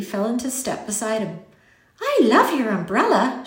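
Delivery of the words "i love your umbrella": put-on high, gushing little-girl compliment; raised, lilting admiration; admiring. A narrator's reading. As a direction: lean into it with delight. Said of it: "she said admiringly".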